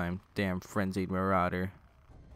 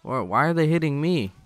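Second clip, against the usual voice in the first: loud, high, exasperated yell; frustrated shout; 6 semitones higher, 7 dB louder than usual. Real, not acted. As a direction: shout it out in disbelief. Hit in game, yelling.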